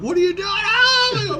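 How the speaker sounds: high-pitched freak-out yelp rising into a scream; mock terrified shriek; imitated panicked scream. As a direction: squeal it like the little guy cornered. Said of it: "screaming, like".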